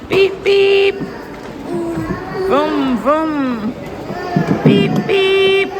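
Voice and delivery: high playful beep and vroom; car noises. Pretend. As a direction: make toy car sounds for a child. Playing car noises for toddler.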